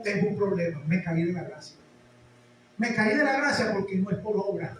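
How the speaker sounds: normal talking voice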